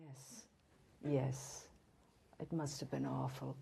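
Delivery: very soft, low murmur; sympathetic soothing. Acted. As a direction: murmur sympathy to calm the speaker. Host soothes the hysterical guest.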